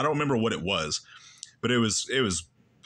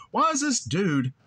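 mock incredulous; 8 semitones higher than usual. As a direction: stretch it out, baffled and high. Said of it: "But I remember thinking".

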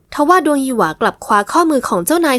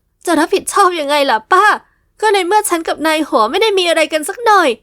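protesting; 6 semitones higher than usual. Acted. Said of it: "หญิงสาวโวย".

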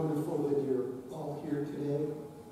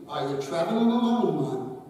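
a hint of Scottish accent, a bit louder; 8 dB louder than usual. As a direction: sketch the Scotsman's Scottish lilt lightly. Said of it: "In his Scottish accent".

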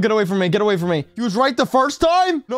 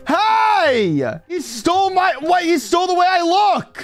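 indignant shout; 6 semitones higher, slower than usual. Real, not acted. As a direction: cry out that he was copied. Other player copied his avatar.

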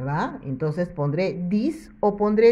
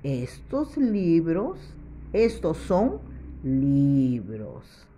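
slow, clearly spaced reading; slow model sentence; slower than usual. Shown as a demonstration. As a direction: spell the sentence out slowly for the class. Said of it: "para que diga".

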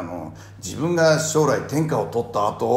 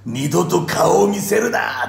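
angry shout; 3 semitones higher, 5 dB louder than usual. Retold or imitated. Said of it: "っつって".

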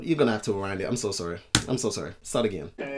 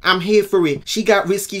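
ordinary delivery

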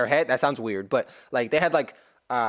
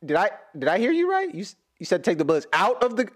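higher, pressing disbelief; a mock soldier doubting the order, raised pitch; incredulous questioning; 6 semitones higher than usual. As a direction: question the commander as if he's lost it. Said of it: "I'd be like".

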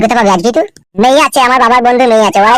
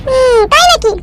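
much higher female voice, admiring; wife character; 13 semitones higher, much slower than usual. Acted. Wife praising husband's new shirt, high female voice. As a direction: play the doting wife, high-pitched.

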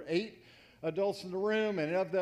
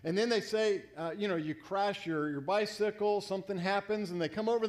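ordinary delivery

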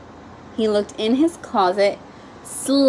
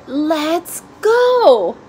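calling out; 6 semitones higher, 5 dB louder than usual. A narrator's reading. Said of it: "called his father".

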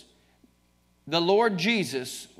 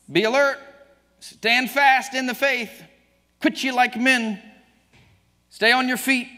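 loud, higher fiery preaching; full force preaching; 7 semitones higher, 6 dB louder than usual. Acted. Preacher exhorting the congregation at full force. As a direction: thunder the verses at the church.

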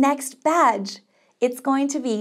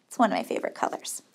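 soft, fast throwaway whisper; quick whispered aside; 4 semitones lower, 7 dB quieter, much faster than usual. Real, not acted. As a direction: toss it off under your breath, quickly. Quick aside while choosing pink.

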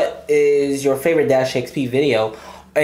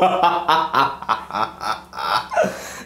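long helpless laughing fit; real laughter; 5 semitones lower, 4 dB quieter than usual. Real, not acted. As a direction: crack up and keep on laughing. Cracking up at the skit.

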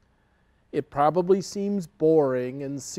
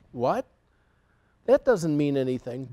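plain reading voice

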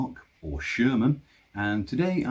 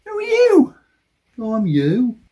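arguing with myself; 10 semitones higher, 6 dB louder than usual. Acted. Arguing with himself, high voice.

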